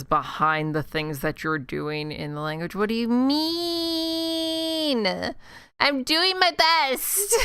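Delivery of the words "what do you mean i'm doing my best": high, slow, whining complaint; whiny mock protest. Complaining to the language app, whiny and dragged high. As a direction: drag it out like a sulky kid, much higher.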